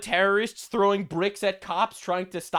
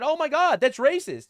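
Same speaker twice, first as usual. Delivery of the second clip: higher, mocking gasp of offense; mock outraged critic; 6 semitones higher, 3 dB louder than usual. Mocking imagined critics, higher mock outrage. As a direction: parody a scandalized critic. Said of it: "I know you're gonna say that".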